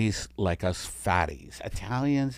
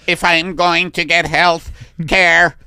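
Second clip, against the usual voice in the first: slow, higher, louder attempt at Italian; botched Italian accent; 6 semitones higher, 4 dB louder, slower than usual. Italian alien asking for forty hours, Italian accent failing. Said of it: "I'm not doing the Italian accent".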